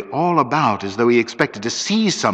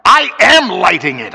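loud, raised angry retort; savage; 8 semitones higher, 7 dB louder than usual. A narrator's reading. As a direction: fire it back savagely. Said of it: "I said savagely".

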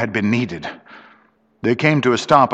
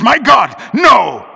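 loud, high cry of refusal; extraordinary emphasis; 12 semitones higher, 7 dB louder, slower than usual. A narrator's reading. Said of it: "with extraordinary emphasis".

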